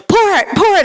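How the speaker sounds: high, loud mock yell of the command; skipper yelling. Skipper on the boat yelling at the crew, imitated. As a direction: bark the order like a stressed skipper. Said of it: "the skipper would yell".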